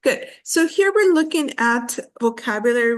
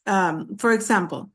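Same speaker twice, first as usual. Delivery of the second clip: normal talking voice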